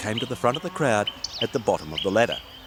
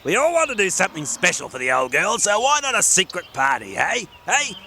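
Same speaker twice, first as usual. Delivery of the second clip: high, bossy little gnome; cheerful small gnome with a plan; Gordon the gnome; 6 semitones higher than usual. Acted. Gordon the gnome voiced in story.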